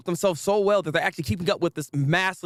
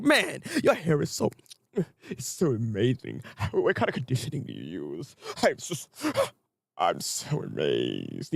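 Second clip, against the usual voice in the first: fawning admirer; 6 semitones lower, 5 dB quieter than usual. Retold or imitated. Vlogger voices a fan character gushing over hair, low and dreamy. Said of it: "the Fabulous guy's like".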